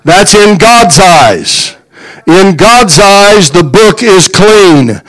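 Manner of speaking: slow, weighty, every word spaced; slow emphasis. Acted. Preacher stressing forgiveness to congregation, slow emphasis. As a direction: lay it down slowly so it sinks in. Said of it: "slowed emphatic sermon point".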